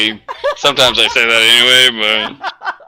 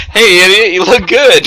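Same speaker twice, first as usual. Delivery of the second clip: loud, high cheerful call; high, booming playful shout; mock enthusiastic greeting; 9 semitones higher, 9 dB louder, slower than usual. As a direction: belt it out like a hammed-up compliment. Acted compliment after insult.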